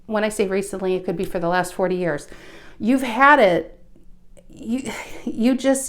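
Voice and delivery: ordinary talk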